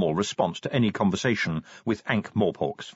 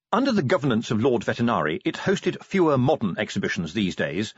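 ordinary delivery

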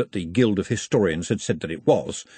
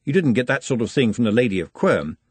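ordinary delivery, nothing marked

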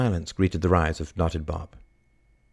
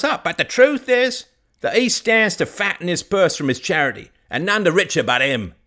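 a hint of a blunt old farmer making his case; lightly voiced old villager; 7 semitones higher, 5 dB louder than usual. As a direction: argue like a plain-spoken countryman. Old Bob argues against priest.

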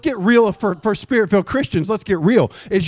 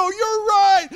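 high, wailed agreement; gullible believer; 13 semitones higher than usual. Acted. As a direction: give in with a hammed-up high cry. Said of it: "We go".

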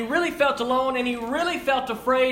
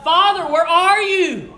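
slow, higher, louder cry to the father; anguished cry; 7 semitones higher, 8 dB louder than usual. Retold or imitated. Preacher voicing Jesus crying out to his father. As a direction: call out desperately, stretched. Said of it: "Jesus cries out".

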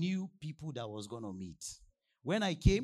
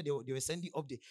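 ordinary talk, nothing marked

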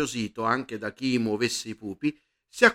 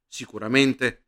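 usual speaking voice

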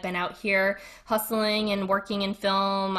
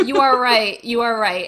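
mock concession; 4 semitones higher, 10 dB louder than usual. Mock surrender after Boston claim.

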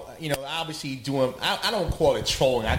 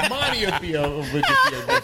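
laughing; 11 semitones higher than usual. Real, not acted. Laughing while talking.

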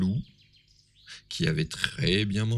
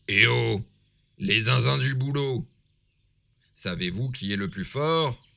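loud, bold wolf calling out; big bad wolf hailing the dwarves; 8 dB louder than usual. A narrator's reading. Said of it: "demande le loup".

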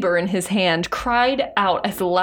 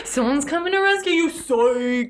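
mock cruel sister. Friend voicing a cruel Sister Anne taunting the doomed wife. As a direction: play the sister mocking the wife with fake hope. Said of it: "Be like".

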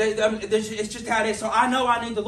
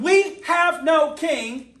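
crowd declaring; 7 semitones higher, 7 dB louder, much slower than usual. Retold or imitated. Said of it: "And they said".

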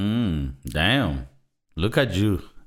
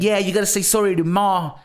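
higher, lightly voiced Mark scolding; imitating Mark; 6 semitones higher than usual. Retelling Mark's scolding scene, lightly voiced imitation. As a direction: mildly mimic the brother telling him off. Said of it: "he's telling him, like".

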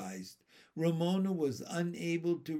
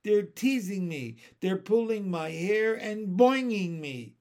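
high, louder tattling complaint; crying out; 5 semitones higher, 6 dB louder than usual. A narrator's reading. Teased girl complaining to her teacher, crying out. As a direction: plead your case, aggrieved. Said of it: "she cried".

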